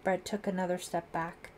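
plain reading voice

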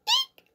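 tiny, very high squeal; little scream. A narrator's reading. Goldilocks wakes to the bears, small high scream. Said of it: "she screamed".

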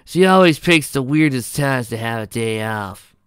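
loud, slow, mild grumble; a touch of exasperation; a bit annoyed. A narrator's reading. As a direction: complain slowly, slightly fed up. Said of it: "a bit annoyed".

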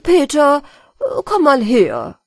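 loud teacher's order; authoritative call from the window; commanding. A narrator's reading. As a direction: summon the boy firmly. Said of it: "befahl der Lehrer".

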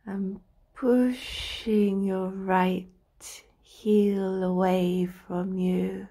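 slow meditation voice with drawn-out words and long pauses; guided relaxation. Acted. Practitioner talking a listener into lying down and stretching, slow guided meditation. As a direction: guide them through the body slowly, stretching each phrase.

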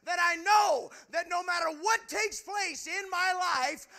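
shouting conviction from the pulpit; preaching at full force. Acted.

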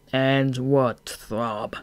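low, controlled demand; cold prompting. A narrator's reading. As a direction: press the underling coldly for the rest. Cold boss pressing his underling.

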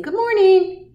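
high, loud cheery greeting; friendly storybook bug, pitched high; friendly ladybug. Acted. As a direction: sing out a friendly hello. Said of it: "She's saying".

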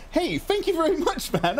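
high, beaming thank-you; excited thanks. Real, not acted. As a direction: lift the thanks way up. Thanking a gift sub.

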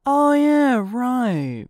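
exclaimed oh yeah; loud. Real, not acted. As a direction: call it out. Loud realisation.

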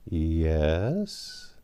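a low, soft, unsure yes; hesitant. A narrator's reading. Charcoal burner hesitantly answering the stranger's question. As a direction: let the yes come out reluctantly. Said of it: "but he said hesitatingly".

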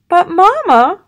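high, loud, rising plea; protesting. A narrator's reading. Sister protesting after Mama hangs up the phone, high and loud. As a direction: object sharply to Mama. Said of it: "protested sister".

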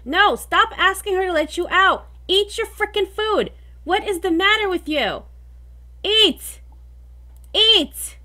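high, loud, frustrated telling-off; exasperated scolding. Real, not acted. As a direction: scold them at the end of your patience. Scolding character who won't eat.

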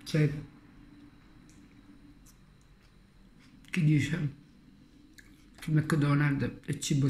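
talking while chewing; speaking between bites; mouth full. Real, not acted. Seen 0: Bites burger before, food visible in mouth while speaking.

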